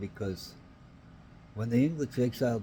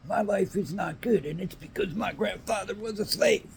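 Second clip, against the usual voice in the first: high put-on whiny complainer; mocking whiner; 11 semitones higher, 5 dB louder than usual.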